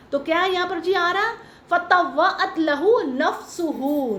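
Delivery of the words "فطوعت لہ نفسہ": slow, measured recitation of the Arabic; Quranic recitation. Shown as a demonstration. Teacher reciting the verse slowly to her class. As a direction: draw out the verse slowly like tajweed. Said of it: "نفسہ کا word میں نے پڑھ دیا".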